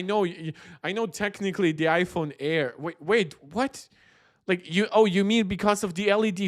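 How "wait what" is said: ordinary talk, nothing marked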